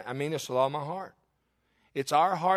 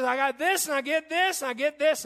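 put-on higher, eager listing voice of a young guy; young gamer bragging; 9 semitones higher than usual. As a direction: rattle off his catches proudly. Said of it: "he says".

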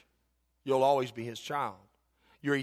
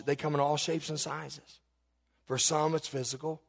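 normal talking voice

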